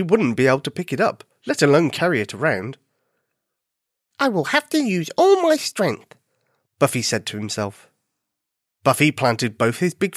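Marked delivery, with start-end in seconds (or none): 4.2-6.1 high, determined little bunny. Acted. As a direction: psych yourself up, high. Bunny psyches himself up.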